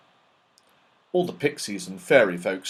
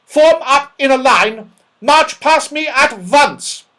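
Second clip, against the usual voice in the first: put-on high, loud furious old dame; fierce loud snap; 14 semitones higher, 10 dB louder than usual. A narrator's reading. Cross dame catching naughty pixies, fierce and loud. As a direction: snap it out to scare the room. Angry dame orders the class.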